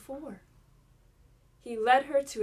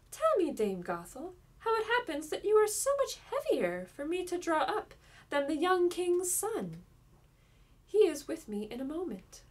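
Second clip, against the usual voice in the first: Rapunzel; 6 semitones higher than usual. A narrator's reading. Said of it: "Rapunzel said to her".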